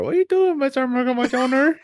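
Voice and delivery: put-on squeaky high baby talk to the dog. Owner cooing at his dog Murphy in a very high baby voice. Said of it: "I'm like".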